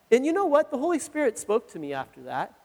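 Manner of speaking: usual speaking voice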